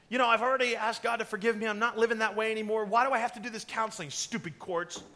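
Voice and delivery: mock indignant venting; mad complaining. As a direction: imitate a friend protesting unfairness. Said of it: "They get mad".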